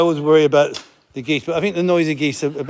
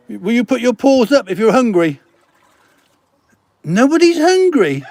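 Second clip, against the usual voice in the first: high and louder pet-talk; talking to kittens; 8 semitones higher, 4 dB louder than usual. Real, not acted. Farmer asks the kittens if hungry, high and playful.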